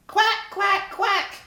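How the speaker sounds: nasal-free bright duck call; high loud duck quack. Acted. Duck calling out across the pond, loud quack.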